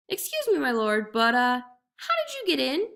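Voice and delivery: high, apologetic asking; timid jackal question. A narrator's reading. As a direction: feign humble curiosity. Jackal asks meekly.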